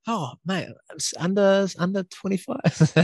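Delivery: high, teasing mock outrage; mock disbelief. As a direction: pretend to be scandalised, jokingly. Acted teasing quote of himself.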